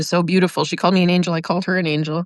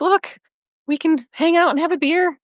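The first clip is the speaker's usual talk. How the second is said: lightly voiced buddy offering a beer, pitched up; casual guy quote; 8 semitones higher than usual. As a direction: mock-friendly invite in a higher voice.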